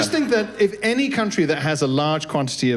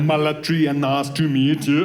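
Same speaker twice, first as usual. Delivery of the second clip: slow, badly faked South African accent; mock South African learned by rote; phonetic gibberish accent. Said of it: "he just learned, like, gibberish syllables".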